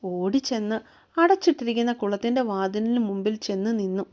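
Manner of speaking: ordinary delivery, nothing marked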